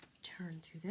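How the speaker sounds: barely voiced, slow whisper to himself. Real, not acted. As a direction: murmur it under your breath while flipping pages. Turning pages, talking to himself.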